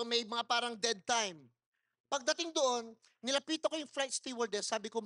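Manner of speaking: ordinary delivery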